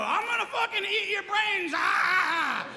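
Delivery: high, hammed-up raving; mock maniac yell. Killer threatening a victim, high hammed-up raving. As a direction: shriek it like a cartoon maniac. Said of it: "Jeffrey Dahmer didn't go".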